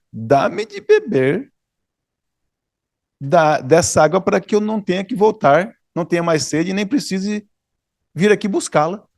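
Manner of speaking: mock her tone with a knowing sneer; ironic. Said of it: "o tom de ironia na voz dela".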